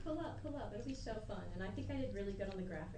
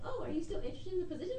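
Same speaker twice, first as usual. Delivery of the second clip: high fast caller voice; 8 semitones higher, faster than usual. Retold or imitated. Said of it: "She's like".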